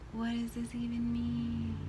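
soft, slow baffled aside; puzzled mutter. Retold or imitated. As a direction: ask it quietly to yourself. Inner puzzled voice.

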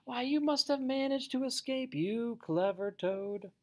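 high-pitched, thrilled praise, slowing at the end; delighted admiration. A narrator's reading. Mole admiring Toad for his escape, delighted admiration. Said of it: "cried the mole".

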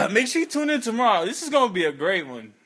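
usual speaking voice

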